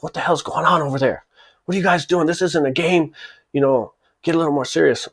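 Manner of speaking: a boss snapping at his men to get serious, lightly imitated; boss yelling. Boss scolds his guys in line, mock angry yell. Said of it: "the boss yells over at his guys".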